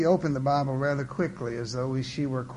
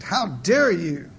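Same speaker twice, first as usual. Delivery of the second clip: slow, indignant outburst, louder; mild shriek; 2 semitones higher, 5 dB louder, slower than usual. A narrator's reading. Offended church wife to her host, indignant shriek. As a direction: draw out the outrage. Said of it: "jerked her head nearly out of its socket and shrieked".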